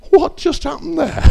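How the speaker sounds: high, wondering, still amazed at it; baffled disbelief. Retold or imitated. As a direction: ask yourself what just happened, high. Recalls his own baffled thought.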